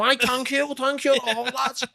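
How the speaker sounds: high, hammed-up thespian voice throughout; milk the pub crowd's applause; pompous retired actor calling to the lads; grand old actor. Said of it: "Probably go down a stone".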